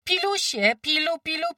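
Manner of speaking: high, loud rising farm call; calling goslings. A narrator's reading. Farmwife calls her goslings back, high rising call. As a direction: sing out to gather the goslings. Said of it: "nawoływała gąsięta".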